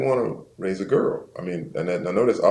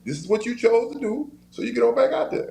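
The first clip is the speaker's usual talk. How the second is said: firm mother. Retold or imitated. Mother telling her son to get back to practice, high and firm. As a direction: lay down the law like his mama.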